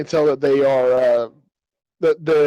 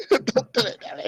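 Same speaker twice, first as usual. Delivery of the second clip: higher, playful squeaky echo; panicked old man reprised; 8 semitones higher, 3 dB quieter than usual. Acted. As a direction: repeat the character's line in a high mock voice. Repeats the old man's line, laughing.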